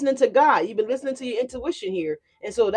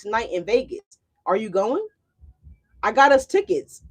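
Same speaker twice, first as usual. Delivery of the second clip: normal talking voice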